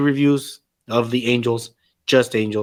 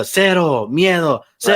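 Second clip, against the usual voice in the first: mocking catchphrase; 4 semitones higher than usual. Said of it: "when he kept doing the".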